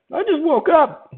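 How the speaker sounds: high, slow mock voice of a just-woken foreign viewer; sleepy foreigner. Streamer imitating a late-night foreign viewer, high and slow. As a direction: drag it out, high and dozy, like someone who just rolled out of bed. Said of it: "you get the foreigners jumping in".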